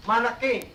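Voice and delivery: malakain said with the Arabic a vowels, mah-lah-kayn; Arabic word. Shown as a demonstration. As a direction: pronounce malakain carefully in Arabic. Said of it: "the word is".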